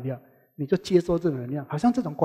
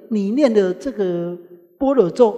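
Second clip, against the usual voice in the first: normal talking voice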